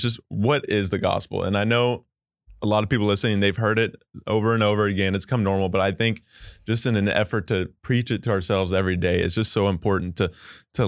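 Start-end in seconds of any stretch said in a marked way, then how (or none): none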